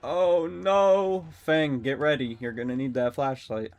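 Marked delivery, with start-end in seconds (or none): 0.0-1.3 loud, high oh no; panicked yelp. Real, not acted. Streamer panicking as the killer closes in.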